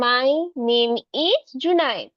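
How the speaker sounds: slow, even model sentence; teacher slowing down a nervous boy's self-introduction; slowly. Shown as a demonstration. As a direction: say each word slowly and calmly. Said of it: "আমরা ধীরে ধীরে বলবো".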